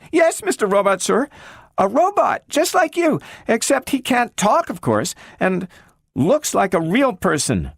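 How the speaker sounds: put-on a small girl asking after her lost friend; eager little girl. A narrator's reading. Gloria asks the talking robot about Robbie, high and hopeful.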